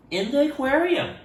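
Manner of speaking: ordinary delivery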